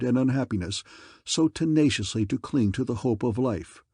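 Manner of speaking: usual speaking voice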